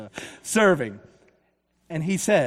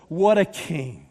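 usual speaking voice

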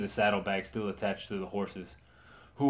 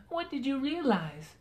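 Margaret's voice. Acted. Margaret asks the knight.

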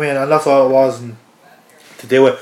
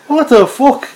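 baffled; 9 semitones higher, slower than usual. Retold or imitated. Retold reaction to weird exit.

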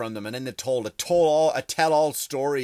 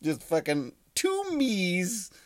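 mock queen voice; 6 semitones higher, much slower than usual. Continues the Queen voice aside.

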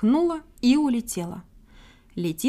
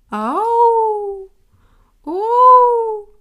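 high, loud wailing call; plaintive moaning call; 11 semitones higher, 9 dB louder than usual. A narrator's reading. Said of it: "Кто-то жалобно стонет".

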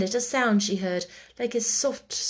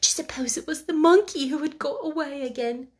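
higher, eager and hopeful; pleased excitement; 6 semitones higher than usual. Acted. Sarah hoping to Becky it is the monkey, excited. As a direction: let the hope rise into the wish. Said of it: "in a pleased excitement".

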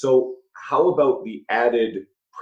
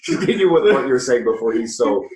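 laughing mock scolding; 6 dB louder than usual. Real, not acted.

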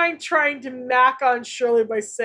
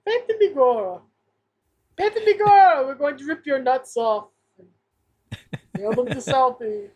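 hammed-up Irish mobster accent from Boston; 2 semitones higher than usual. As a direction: threaten like a South Boston Irish gangster, accent laid on.

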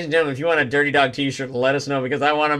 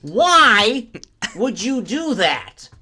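very slow, high, loud; high voice breaking into laughter; David Lynch; 9 semitones higher, 5 dB louder, much slower than usual. Acted. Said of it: "David Lynch going to".

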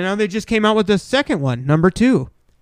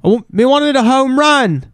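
dumb ballplayer; 7 semitones higher than usual. Pretend. Dumb batter voice after bunt.

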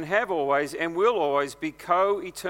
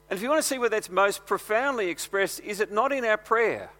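raised preaching voice, steady conviction; earnest pulpit delivery, a notch higher; 5 semitones higher, 4 dB louder than usual. Acted. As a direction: press the point to the congregation. Preaching continues.

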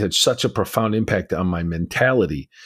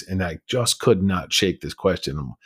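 ordinary talk, nothing marked

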